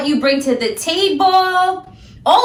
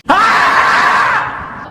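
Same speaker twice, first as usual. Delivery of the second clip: frustrated yell; 9 semitones higher, 4 dB louder than usual. Real, not acted. Groaning about a hard year.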